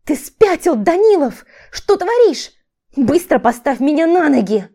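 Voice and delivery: high, loud angry cries; frantic, indignant yelling; outraged shouting. A narrator's reading. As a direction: shout at him to let you go. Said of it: "кричала Виктория".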